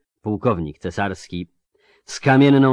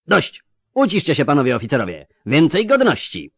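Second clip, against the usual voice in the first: high, loud commanding shout; stern shout; 8 semitones higher, 8 dB louder than usual. Acted. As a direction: order them to be silent. Commander silences shouting officers.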